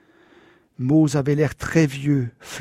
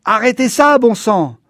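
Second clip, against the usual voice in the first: angry yell; 7 semitones higher, 6 dB louder than usual. A narrator's reading. Father yelling at the mob.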